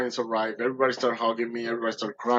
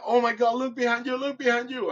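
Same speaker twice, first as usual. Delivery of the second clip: high-pitched, excited repeated urging; astonished retold alert; 10 semitones higher than usual. Boatman spots the dolphin behind swimmer, astonished. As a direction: get him to turn round, voice way up.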